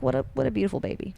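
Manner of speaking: high, soft, quick coo; mock adoring. Retelling young Tristan admiring the baby, hushed adoring coo. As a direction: imitate a boy softly cooing in wonder.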